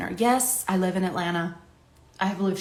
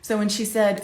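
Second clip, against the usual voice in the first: ordinary talk, nothing marked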